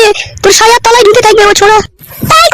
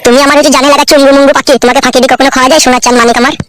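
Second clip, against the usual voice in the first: doting husband; 9 semitones lower than usual. Acted. Husband sweet-talking wife over fish, deep fond voice.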